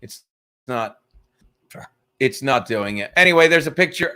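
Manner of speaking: normal talking voice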